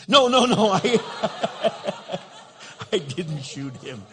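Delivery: loud laughing denial. Real, not acted. Pastor taking back the joke to the congregation, laughing loudly. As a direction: laugh off your own joke.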